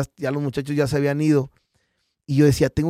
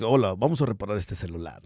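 lower, softer disguised voice; put-on deep voice; 7 semitones lower, 5 dB quieter than usual. Shown as a demonstration. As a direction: keep the voice low so nobody knows it's you. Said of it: "Entonces era que". (voz grave fingida, un poco más suave)

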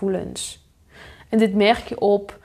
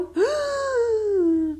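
sharp, high intake of breath; shocked gasp; 13 semitones higher than usual. Acted. Seeing a missed call from parents, a sudden shocked gasp. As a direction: gasp at the phone screen.

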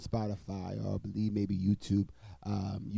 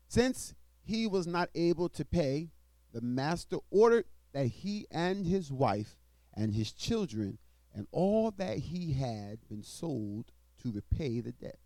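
scripture reading; 4 semitones higher than usual. Acted. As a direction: proclaim the verses to the congregation. Reads scripture aloud in sermon.

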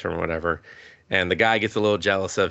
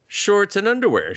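higher, louder matter-of-fact reply; high mock answer; 12 semitones higher, 4 dB louder than usual. Tomo answering her friend about the skirt, high and innocent.

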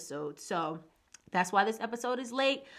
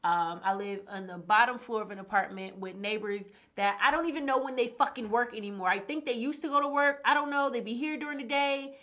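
usual speaking voice

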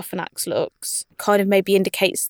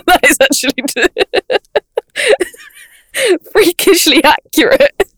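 laughing; 11 semitones higher, 7 dB louder than usual. Real, not acted. Laughing at the bat face.

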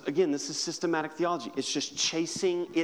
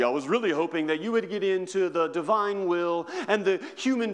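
mock know-it-all. Preacher mocking a theology-obsessed listener, hammed up. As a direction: ham up a smug churchgoer. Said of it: "Can we never just become like".

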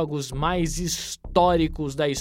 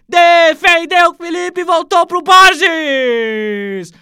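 higher, louder, drawn-out goal call; explosive goal commentary; 10 semitones higher, 9 dB louder, slower than usual. Acted. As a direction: shout the goal to the stands. Live play-by-play of second goal.

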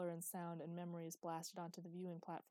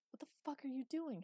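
higher, mildly hissed reproach; 7 semitones higher than usual. A narrator's reading. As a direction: challenge a companion in tense disbelief. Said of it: "hissed Luke, grabbing Eli's arm".

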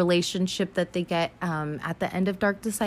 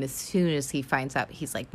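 usual speaking voice

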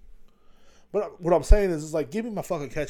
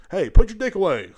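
loud, higher comic holler; calling out; 6 semitones higher, 9 dB louder than usual. Acted. Host showing listeners what to yell, loud call. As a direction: holler it like a telling-off.

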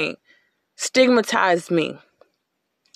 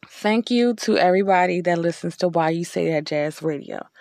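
normal talking voice

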